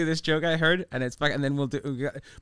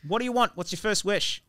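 put-on Australian joke-telling voice; bad Australian accent. Comic telling a bartender street joke, put-on Australian accent. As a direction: tell it in a loose Australian accent. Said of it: "it has to be done in an Australian accent".

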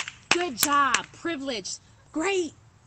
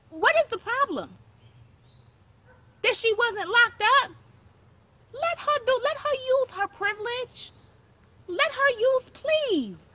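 impassioned; 9 semitones higher than usual. Real, not acted. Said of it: "I be trying not to talk like this".

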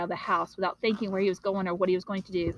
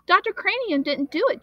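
put-on upset boy; 10 semitones higher, 4 dB louder than usual. Boy insisting, scared.

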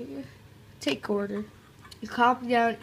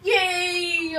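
higher, louder cheer; excited yay; 8 semitones higher, 6 dB louder than usual. Acted. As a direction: cheer like a delighted kid.

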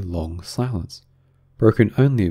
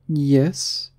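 high, hesitant yes; doubtful; 7 semitones higher than usual. A narrator's reading. Alice answering the Griffin, doubtful. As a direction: answer unsurely in a raised voice. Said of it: "Alice said doubtfully".

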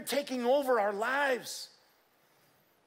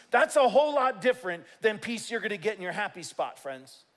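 normal talking voice

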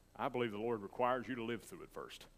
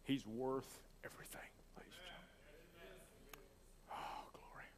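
choked, soft whisper; overcome, barely getting it out; real emotion, whispered; emotional whisper; 4 semitones higher, 10 dB quieter than usual. Real, not acted. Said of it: "I'm so emotional".

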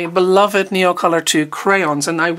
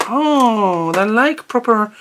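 usual speaking voice

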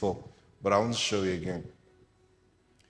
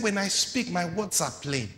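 forceful sermon reading; preaching at full force; 9 semitones higher than usual. Acted. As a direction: proclaim it to the crowd.

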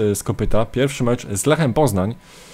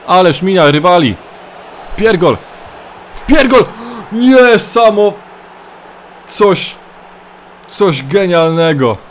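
much higher, louder, slow drawn-out scream; rapturous commentator scream; ecstatic goal call; 7 semitones higher, 6 dB louder, slower than usual. Acted. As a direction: lose it as the hat-trick goes in. Hat-trick goal called in rapture.

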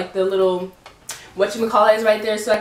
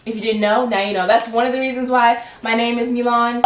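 ordinary delivery

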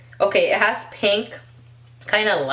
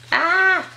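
excited squeal; 10 semitones higher, 3 dB louder than usual. Real, not acted. Squeals at a bottle.

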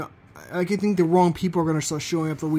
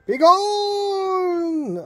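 unearthly shout; 12 semitones higher than usual. Acted. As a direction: shout it like a ghost. Ghost voice threatens visitors.

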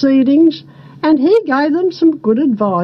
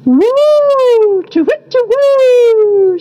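high, long owl hoots; wise owl; 10 semitones higher, 4 dB louder, much slower than usual. A narrator's reading. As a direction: hoot it slowly like an owl. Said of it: "said Mister Wise Owl".

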